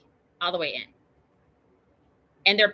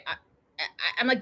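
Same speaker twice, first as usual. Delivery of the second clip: normal talking voice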